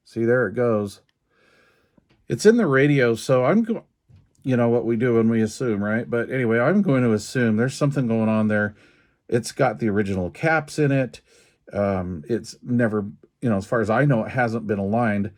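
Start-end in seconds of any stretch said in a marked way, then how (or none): none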